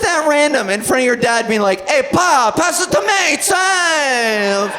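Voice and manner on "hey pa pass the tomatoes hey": mock New York Italian calling to dad; Italian-American accent. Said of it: "putting on an accent".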